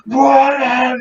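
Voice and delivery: high-pitched mock outburst of disbelief; exasperated kid. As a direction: imitate an irritated kid's cry. Retelling irritated kid's outburst.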